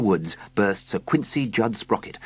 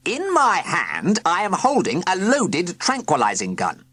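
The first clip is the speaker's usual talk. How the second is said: stern villain trying again; 10 semitones higher, 4 dB louder than usual. A narrator's reading. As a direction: drag it out to be heard. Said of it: "Sir Quincy tried again".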